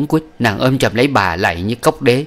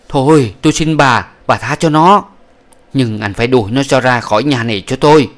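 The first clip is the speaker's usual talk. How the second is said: pleading then demanding; 4 semitones higher, 6 dB louder than usual. Acted. Begging then demanding in dispute.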